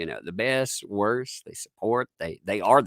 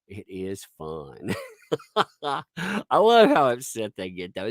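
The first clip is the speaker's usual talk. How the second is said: ordinary talk, nothing marked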